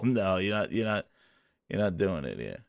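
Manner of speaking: very low, hushed grumble; barely voiced, low and soft; muttering to self. Real, not acted. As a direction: scold the screen under your breath. Muttering at uncooperative screen.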